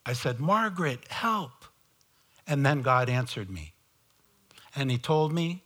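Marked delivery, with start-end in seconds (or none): 0.4-1.7 retold cry for help. Preacher retelling his desperate call to Margaret, mock cry for help. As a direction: call out a small, high-pitched imitated plea. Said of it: "I cried out to Margaret".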